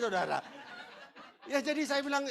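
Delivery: normal talking voice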